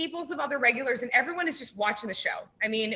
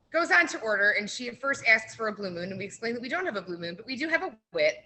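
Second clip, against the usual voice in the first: ordinary talk, nothing marked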